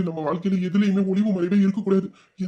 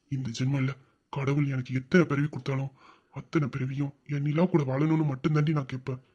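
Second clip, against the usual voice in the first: tender vow; 6 semitones lower, 4 dB quieter than usual. Acted. Husband's tender love vow.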